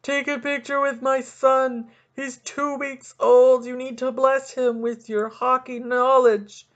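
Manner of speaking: high, loud, pleading fan; hammed-up overeager hockey fans; pushy fans. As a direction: beg the star like a gushing parent. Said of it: "fifty more people after that".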